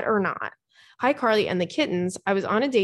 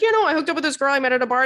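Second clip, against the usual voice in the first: fast, higher mock man's voice; oversharing guy; 5 semitones higher, 4 dB louder, much faster than usual. Imitating men who overshare about hookups, quick and casual. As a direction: rattle off a casual confession as a mocked guy.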